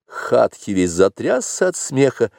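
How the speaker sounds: ordinary delivery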